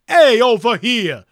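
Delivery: hammed-up Brooklyn Italian holler; Brooklyn Italian accent. Host proudly showing off his Brooklyn Italian, hammed-up accent. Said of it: "I'm sounding Brooklyn Italian".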